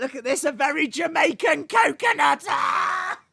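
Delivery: high, excited squeal; delighted shout. Real, not acted. Streamer spotting a silly viewer name, delighted shout.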